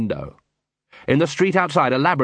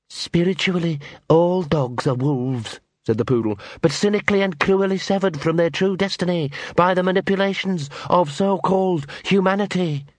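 snooty poodle voice; 6 semitones higher than usual. Acted. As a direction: recite dogma like a fanatic. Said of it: "It sounded like a quote".

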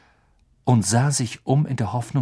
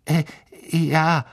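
nervous; 6 semitones higher than usual. A narrator's reading. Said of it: "sagte Neville nervös".

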